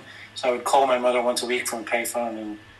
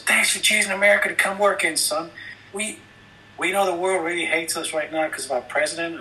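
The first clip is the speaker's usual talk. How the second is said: higher-pitched officer's little speech; lightly voiced cop addressing a youngster; imitated friendly cop; 5 semitones higher than usual. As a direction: play a cop thanking a visitor. Cop gives friendly speech in story.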